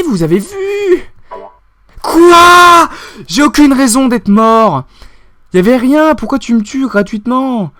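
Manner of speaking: loud, high outraged protest; indignant outburst. Real, not acted. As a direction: complain to the game as if wronged. Indignation après une mort. (protestation indignée et aiguë)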